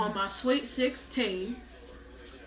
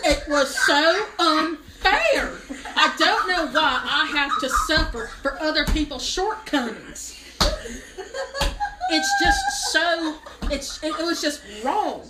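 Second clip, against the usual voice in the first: hammed-up laughing wail, louder; mock wail; 5 semitones higher, 5 dB louder than usual. To the audience, mock-sobbing over the unfair trip. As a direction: overact the injustice, voice breaking high.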